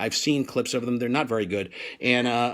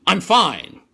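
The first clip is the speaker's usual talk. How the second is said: 1 curt; 6 semitones higher, 8 dB louder, slower than usual. Retold or imitated. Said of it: "he said".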